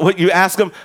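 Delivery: ordinary delivery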